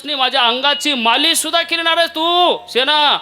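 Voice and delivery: a baffled king insisting; astonished king. Acted. (गोंधळलेला राजा सेनेला उंच आवाजात सांगतो)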